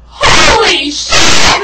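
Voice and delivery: loud, high, drawn-out exclamation; hollered in slow astonishment; mock amazed shout. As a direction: stretch it out in a big shout. Acting out guy's exclamation.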